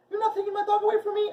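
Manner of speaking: very high, loud mock protest; imitating mom. Her mom refusing to give up the dog, mock high. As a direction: shriek it like a hammed-up mom. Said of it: "And she's like".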